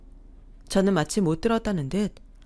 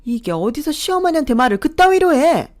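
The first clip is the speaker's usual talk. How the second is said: loud, much higher sputtering fury; enraged mother-in-law; 6 semitones higher, 10 dB louder than usual. Acted. Mother-in-law shouting at daughter-in-law who talked back, enraged. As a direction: start stammering, then shout her down. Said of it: "화가 나셨는지 얼굴이 시뻘겋게 달아올랐어요".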